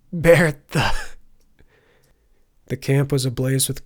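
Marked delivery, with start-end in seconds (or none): 0.1-0.9 loud, delirious broken cry; half-laughing, half-sobbing. A narrator's reading. Dazed Turner names the bear, half-laughing, half-sobbing. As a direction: cry it out between laughter and sobs. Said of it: "a half-laughing, half-sobbing sound".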